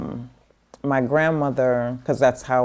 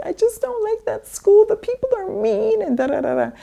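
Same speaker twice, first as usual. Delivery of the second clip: put-on high whiny child's voice, much higher; whiny kid. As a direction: complain like a sulky little kid, pitched way up. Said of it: "I was just like".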